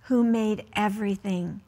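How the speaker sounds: slow, careful picture-book read-aloud; reading to children. Acted. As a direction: read each line slowly to a child at bedtime.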